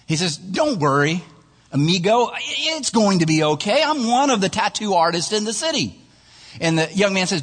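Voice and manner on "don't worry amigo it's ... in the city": higher-pitched, cocky tattoo artist; breezy reassurance. Acted. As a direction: reassure the nervous client with easy bravado.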